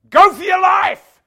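huge high-pitched send-off yell; acted shout. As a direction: bellow it out, slow and high. Official sends new immigrants off.

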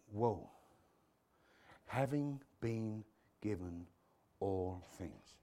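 low, slow, hushed wonder; hushed awe. Acted. Preacher voicing someone gazing at a baby, hushed awe. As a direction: breathe it out softly in amazement.

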